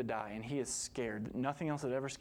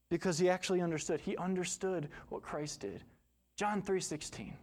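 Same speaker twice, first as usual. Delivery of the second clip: fervent preaching; 4 semitones higher than usual. Acted. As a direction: drive the point home from the pulpit. Preaches at full force.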